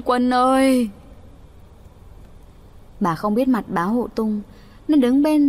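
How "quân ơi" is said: wailing cry. Acted. Mother wailing over her dead son, grief-stricken. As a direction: call the dead son's name in grief.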